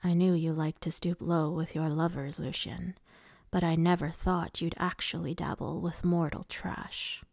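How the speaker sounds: low voice, contemptuous taunt; sneering villain lord. Acted. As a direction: look down on him with low-voiced scorn. Voices Rhysand sneering at Lucian.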